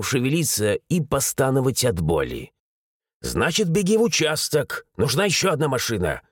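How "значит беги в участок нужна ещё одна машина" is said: usual speaking voice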